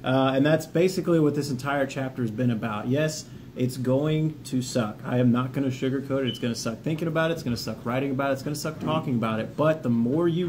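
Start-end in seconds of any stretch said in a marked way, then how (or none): none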